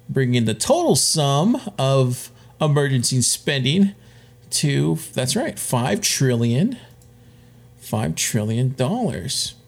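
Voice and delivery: slow, drawn-out build to the number; dramatic slow reveal. Acted. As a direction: let each word land, slowly.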